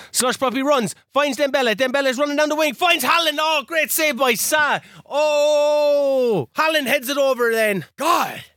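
high, building commentary into a groan; excited play-by-play. Real, not acted. Player calls Haaland's near miss, excited commentary. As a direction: call the attack urgently, then agonise over the miss.

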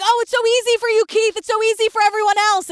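mock complaining. Host imitating the killer complaining to Keith, mock aggrieved.

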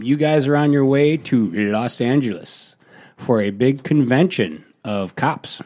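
ordinary delivery

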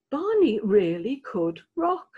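ordinary delivery, nothing marked

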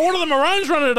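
high, loud mock pestering; hammed-up nosy mate; pushy fan. Mocking grabbed footballer.

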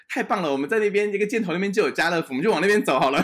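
high, loud, delighted mock cheer; excited announcement. To lost friends on the trail, thrilled loud cheer. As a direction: sell the good news with a grin. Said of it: "然后我就说".

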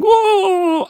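high mock crying wail of a slave woman. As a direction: let out a high, hammed-up sobbing "oh". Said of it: "she was crying".

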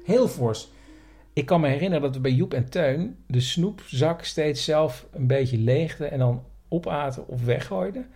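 normal talking voice

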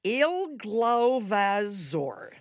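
very slow, drawn out Il Glovazor; Il Glovazor drawn out slowly; slowly reversed name. Shown as a demonstration. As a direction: stretch out Il Glovazor. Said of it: "say her last name backward".

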